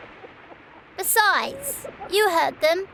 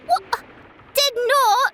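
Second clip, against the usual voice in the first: sibling bicker; 4 semitones higher than usual. Acted. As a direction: snap back at your sister.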